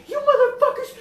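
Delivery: high, loud mock yell; enraged Mexican mom. Mom pulls up on kids at bus stop, enraged yell. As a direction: imitate a mother yelling at strangers. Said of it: "that's how I impersonate her".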